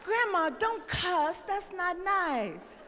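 put-on a little girl correcting her grandma, slowly; scolding granddaughter. Granddaughter primly scolding grandma for cussing. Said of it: "What the fuck you know about fine".

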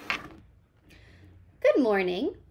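slow, drawn-out cheerful greeting; read-aloud friendly bug; friendly ladybug voice. A narrator's reading. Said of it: "said the friendly ladybug".

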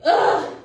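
loud exasperated groan; sharp disgusted groan; frustrated ugh. Real, not acted. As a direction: let out a loud fed-up ugh. Frustrated over copyright flag.

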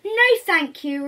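loud, slow, firm little refusal; little creature. Acted. Creature turning down the wolf, loud and slow. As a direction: plant your feet and say no. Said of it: "replies the little creature".

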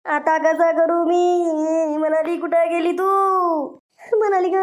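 slow, drawn-out wailing; acted tearful lament; crying. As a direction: wail for the lost girl. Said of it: "नका रडू नका तुम्ही".

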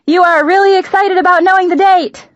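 high, loud mock-excited yell; yelling back. A narrator's reading. Daughter answering her overexcited mom, yelling back. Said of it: "I yelled back".

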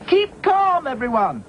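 rally them over the storm; yelled. A narrator's reading. Dotty yells to the crew in the storm. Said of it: "yelled Dotty".

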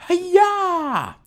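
high, drawn-out "hi-yah"; playful battle cry. Pretend. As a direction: yell it like a karate chop. Charging attack in the game.

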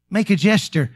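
ordinary talk

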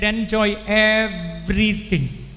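slow, loud voice of God; preacher voicing God's warning to Adam and Eve; God's command. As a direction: lay down the one rule as God. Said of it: "and he said".

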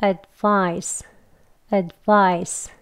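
"advice" with the d touched and released into "vice"; 또박또박 "advice"; careful demo. Shown as a demonstration. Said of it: "두 음절 연결해서 발음을 하면".

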